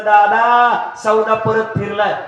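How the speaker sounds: loud, high bad news; son crying out. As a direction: wail that the deal fell through. Third son cries the news. (मोठ्याने वाईट बातमी ओरडणारा मुलगा)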